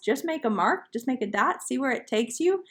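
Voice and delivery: ordinary delivery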